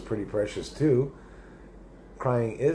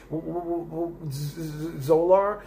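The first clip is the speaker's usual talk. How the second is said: imitating a stutter; 8 semitones higher, slower than usual. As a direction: mimic the host tripping over the name. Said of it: "And he stuttered".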